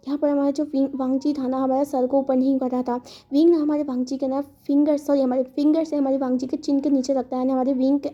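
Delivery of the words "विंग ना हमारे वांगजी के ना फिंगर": ordinary delivery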